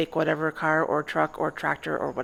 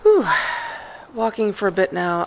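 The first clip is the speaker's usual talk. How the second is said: puffing from the long walk; breathy and winded; out of breath; 5 dB louder than usual. Real, not acted. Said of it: "I'm starting to get pretty sweaty".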